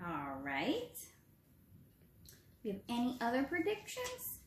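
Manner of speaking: normal talking voice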